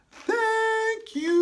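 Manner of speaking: very high, sung-out thanks; Dory's thank you. Shown as a demonstration. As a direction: say it with the kids, way up high. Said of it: "Can you say it with me?".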